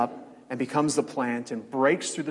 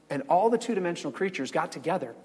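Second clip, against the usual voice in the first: ordinary talk, nothing marked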